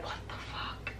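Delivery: breathy disbelieving whisper, almost no voice; whispered what the fuck; stunned whisper. Retold or imitated. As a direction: mouth it in shock. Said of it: "I was just like".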